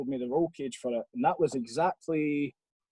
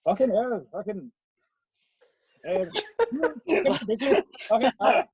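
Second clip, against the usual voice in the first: higher-pitched put-on Welsh accent, laughing through it; mock Welsh accent; 7 semitones higher, 4 dB louder than usual. Friend mimicking German Max, in a mock Welsh accent. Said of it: "so I'll do a Welsh one instead".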